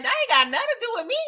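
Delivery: fast, high, dismissive snap; snapping back. Retold or imitated. Rock shuts down Kanan's request, fast high snap. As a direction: brush him off, quick and sharp. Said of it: "She was like".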